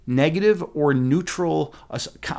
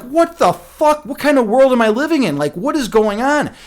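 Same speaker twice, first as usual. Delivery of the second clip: stunned disbelief; 8 semitones higher, 4 dB louder than usual. Retold or imitated. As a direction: drag it out, floored by what just happened. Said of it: "I was like".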